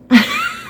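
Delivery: high, bright burst of laughter. Real, not acted. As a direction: laugh at being caught out. Laughing after being tricked.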